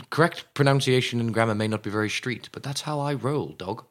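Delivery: soft, prim posh British delivery; mock-posh British. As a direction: play it posh and understated. Mock-posh reply about street talk.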